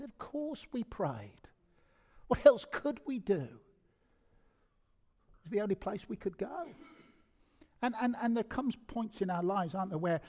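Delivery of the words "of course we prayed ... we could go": mum's voice. Retold or imitated. Mum insists they prayed.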